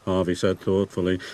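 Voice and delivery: ordinary delivery, nothing marked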